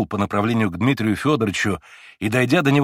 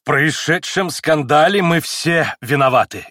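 heated; 4 semitones higher, 5 dB louder, slower than usual. A narrator's reading. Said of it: "горячо проговорил он".

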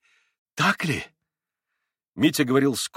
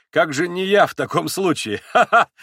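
bitter laugh; 6 semitones higher, 6 dB louder than usual. A narrator's reading. Mitya mocking the case against him, bitter laugh. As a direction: question breaking into a loud, high laugh.

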